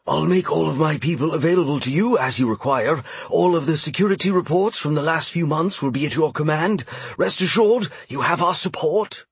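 airy high voice gliding over silence; breathy voice. Acted. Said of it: "his somewhat breathy voice almost sliding".

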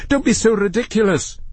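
high-pitched exasperated snap; snapping. A narrator's reading. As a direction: snap in sheer impatience. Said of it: "snapped Matthew".

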